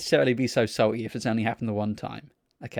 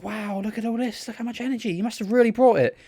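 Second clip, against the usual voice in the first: imitating a gushing host, high-pitched; high, hammed-up wonder; mock awe; 10 semitones higher than usual. Host marvelling at the woman's energy, mock awe.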